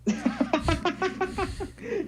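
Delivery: long, high burst of laughter; helpless laughter. Real, not acted. Laughing at a friend's failed accent, helpless high laugh. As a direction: crack up, high-pitched.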